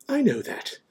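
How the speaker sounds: high, testy snap; irritable. A narrator's reading. McGonagall answering Dumbledore's excuse, irritable. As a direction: cut back testily. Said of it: "said Professor McGonagall irritably".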